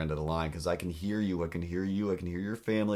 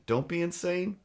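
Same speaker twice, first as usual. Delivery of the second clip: higher, odd put-on voice; mock the landlord in a silly voice; squeaky mock voice; 10 semitones higher than usual. Shown as a demonstration.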